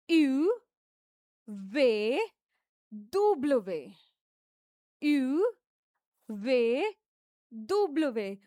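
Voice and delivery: दुब्लवे said slowly as doo-bluh-vay; teacher repeating French letters; French letter names. Shown as a demonstration. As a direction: model दुब्लवे carefully. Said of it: "फिर से repeat करते हैं".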